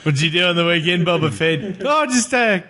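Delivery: laughing Kiwi accent impression; New Zealand accent. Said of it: "horrible New Zealand voice".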